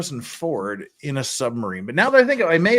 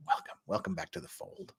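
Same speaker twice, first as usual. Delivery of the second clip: soft secretive whisper; 5 semitones lower, 13 dB quieter than usual. Acted. Whispering a welcome back to Stray. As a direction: breathe the welcome without voice.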